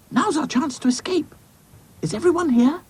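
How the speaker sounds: high, urgent doll voice; rally the group for an escape; Dotty, brisk doll. Acted.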